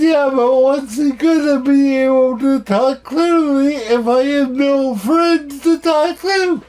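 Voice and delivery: acted protest climbing in pitch; protesting retort. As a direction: argue back like an exasperated kid. Acted retort to his therapist.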